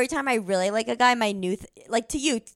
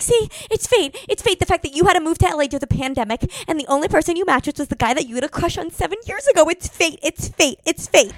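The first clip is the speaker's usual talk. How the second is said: much higher, swooning mock excitement; giddy fate; 8 semitones higher, 4 dB louder than usual. Their younger selves gushing over a match, giddy. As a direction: gush like a lovestruck teen. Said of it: "we'd be like".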